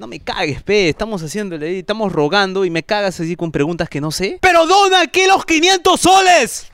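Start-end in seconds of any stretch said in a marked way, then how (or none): 4.4-6.7 high, raised demanding yell; exasperated shout. Acted. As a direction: demand it at the top of your voice. Exige donación, harto de preguntas. (grito exasperado y exigente)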